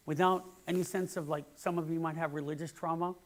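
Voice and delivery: ordinary talk, nothing marked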